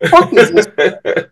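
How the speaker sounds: high, laughing "What the fuck is this?", slow on the end; mock outrage through laughter; laughing disbelief. Retelling his shock at the visit.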